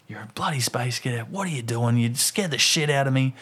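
retold scolding with a touch of anger; understated exasperated dad; angry. Dad replays telling off his kid after a scare, mildly angry. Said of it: "angry as".

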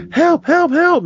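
put-on fleeing boy shrieking for help, very high; panicked cry for help. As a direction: shriek for help while pounding doors. Fleeing boy pounds doors.

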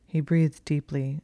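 plain reading voice

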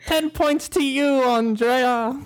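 high, hammed-up British announcement; high-pitched fake British; horrible British accent. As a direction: award it in a mock British accent. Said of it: "these horrible accents".

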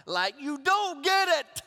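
high, loud, drawn-out exasperation; acted frustration. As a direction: let pent-up frustration burst out slowly. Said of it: "have you ever been really frustrated inside".

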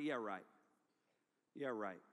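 very low, soft scoff; mock a dismissive teen muttering doubt; sceptical. Camper doubting a loving father, low and dismissive.

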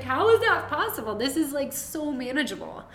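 high, loud amazed disbelief; astonished high squeal. Retold or imitated. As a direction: replay her stunned reaction. Hearing she is in labor.

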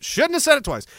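high, playful repeat of the line; mock regret. Repeats the line for comic effect.

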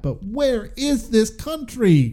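high, drawn-out incredulous question; exaggerated bewilderment. Real, not acted. Exaggerated bewilderment at film's setting.

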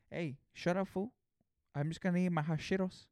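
a lightly voiced teasing bro; mock heckler voice. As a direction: play a mouthy friend telling him off.

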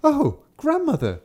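put-on high, slow, wondering child; little girl voice. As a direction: gasp it out innocently. Kapturek przy łóżku.

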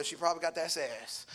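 ordinary delivery

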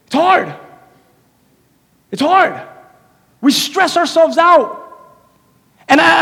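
loud, high, driving sermon voice; preaching at full force. Real, not acted. Pastor preaching to his church, loud and driving. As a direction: preach it hard to the congregation.

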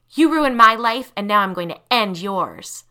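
loud, hard, vengeful declaration; vengeful threat. Acted. Tanya vowing to kill Lisa, vengeful. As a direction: spit out the revenge.

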